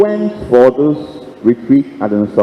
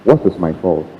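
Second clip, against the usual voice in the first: usual speaking voice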